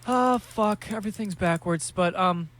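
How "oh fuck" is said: ordinary delivery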